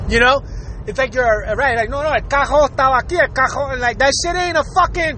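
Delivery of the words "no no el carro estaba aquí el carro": fast Puerto Rican Spanish with guttural R; throaty Puerto Rican accent. Retold or imitated. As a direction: imitate a Puerto Rican speaker scraping the R. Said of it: "a loogie in the back of their throat".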